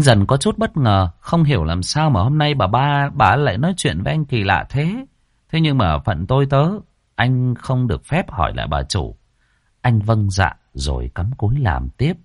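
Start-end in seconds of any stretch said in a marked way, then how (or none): none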